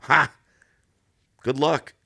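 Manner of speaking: loud, high scoffing "ha" and send-off; retold derisive jeer; mocking laugh. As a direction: imitate someone laughing in your face. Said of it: "he laughs in my face".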